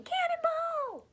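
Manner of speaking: playful cannonball whoop; cannonball yell. Acted. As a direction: shout it while jumping in. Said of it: "I bet you he's thinking".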